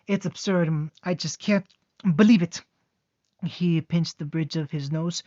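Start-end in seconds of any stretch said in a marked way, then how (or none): 0.0-2.6 building to a loud, high exclaim; mounting disbelief. Acted. Naruto scoffing at the soldiers, rising to a shout.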